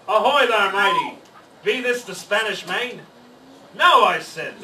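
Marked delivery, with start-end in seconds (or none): 0.1-3.0 loud, higher pirate hail; pirate yell. Acted. Pirate captain hails the boy on the beach, loud. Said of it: "the head pirate climbed out of the boat and yelled". 3.8-4.1 loud no. Acted. Boy answers the pirate.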